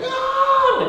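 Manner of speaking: high, loud mock scream of terrified kids; kids' scream. Kids shriek as Batman jumps off the roof, high mock scream. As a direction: scream like kids scared out of their wits.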